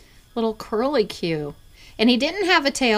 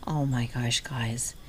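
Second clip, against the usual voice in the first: lower, hushed gasp of wonder; awed whisper; 7 semitones lower, 6 dB quieter, slower than usual. Real, not acted. Telling viewers about a rare ornament, in a stunned whisper. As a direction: let the surprise come out as a whisper.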